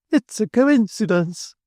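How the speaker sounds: high, slow, breathless Mrs Sparsit; excited exclamation. A narrator's reading. Mrs Sparsit to Rachel and Sissy, high excited exclamation. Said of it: "exclaimed Missus Sparsit".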